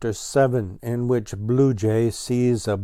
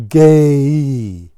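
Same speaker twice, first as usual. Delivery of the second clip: excited; 4 semitones higher, 12 dB louder than usual. Acted. Remembering the next number.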